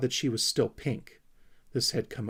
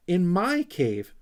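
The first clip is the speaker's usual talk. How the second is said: proud squeak; 7 semitones higher, 6 dB louder, slower than usual. A narrator's reading. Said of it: "squeaked Sniff proudly".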